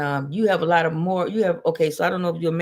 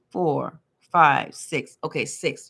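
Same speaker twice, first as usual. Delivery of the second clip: usual speaking voice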